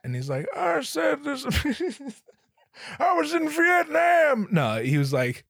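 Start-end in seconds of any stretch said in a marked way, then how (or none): 0.6-4.5 put-on high, thin old man voice, then a shout; hammed-up high old man shouting. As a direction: squeak it out like a frail old guy.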